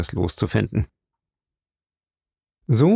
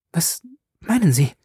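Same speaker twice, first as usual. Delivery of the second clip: high, alarmed question; startled; 9 semitones higher than usual. Acted. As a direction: blurt it out in sudden fright. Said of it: "stieß sie erschreckt hervor". (erschreckt hervorgestoßen)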